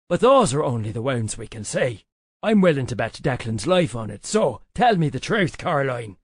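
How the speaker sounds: low-pitched, forceful character demand. A narrator's reading. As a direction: press firmly for the truth. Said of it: "Cedric demanded as he cut".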